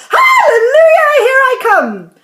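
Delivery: high, loud crone's cry of glee; old woman shouting. A narrator's reading. Grandma shouting with joy as she grows upward. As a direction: belt it out like a giddy old grandma. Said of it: "she shouted, finding her voice at last".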